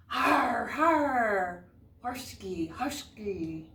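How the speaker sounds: loud, booming villain's laugh, har har horski; big evil laugh. Acted. Teacher voicing the Norwegian dinosaur for her class, loud mock evil laugh. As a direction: belt out a hammed-up evil cackle. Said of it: "a big, evil laugh".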